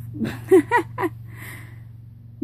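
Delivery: airy high-pitched giggle; high breathy laugh. Real, not acted. Laughs at own story.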